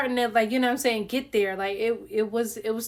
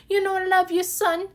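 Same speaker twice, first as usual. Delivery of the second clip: pleading mother; 8 semitones higher than usual. Retold or imitated. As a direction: beg like a doting mother, pitched high. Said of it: "his mom used to be like".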